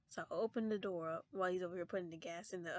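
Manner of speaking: ordinary talk, nothing marked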